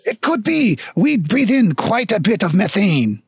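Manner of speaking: lilting, hammy French dwarf; mock-French with swooping pitch; bad French accent. Stilt dwarf concedes the swamp fumes, bad French accent. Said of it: "very shitty French accent".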